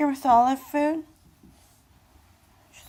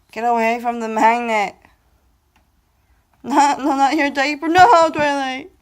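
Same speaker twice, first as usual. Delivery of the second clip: louder, sharp scolding shout; raised voice, stopping the pet; 3 semitones higher, 9 dB louder than usual. Real, not acted. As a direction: yell the pet off the diaper.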